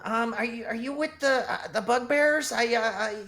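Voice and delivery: high, timid goblin stammering in fear; frightened high goblin pleading. Acted. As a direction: squeak it out like a scared little goblin.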